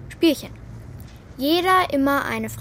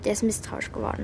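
softer, hushed warning; urgent whisper; 3 semitones lower, 5 dB quieter than usual. Acted. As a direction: whisper so the stranger can't hear. Martha warnt Bela heimlich. (geflüstert, eindringlich)

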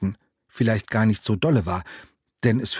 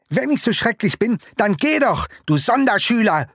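much higher, louder furious scream; screaming at him; 11 semitones higher, 4 dB louder than usual. Acted. Hurt boy screaming insults at his friend, furious. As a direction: scream it so loud the ducks fly off. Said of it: "schrie er mich dermaßen laut an".

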